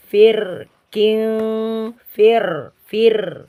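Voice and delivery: fir with light r; fir, thin r; repeated light fir. Shown as a demonstration. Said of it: "Jadi fi".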